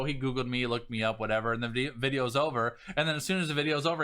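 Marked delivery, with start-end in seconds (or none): none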